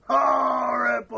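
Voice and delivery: slow, loud pinched-nose shout; nasal shout. Shown as a demonstration. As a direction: drag it out holding your nose. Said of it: "let me hear you say it again".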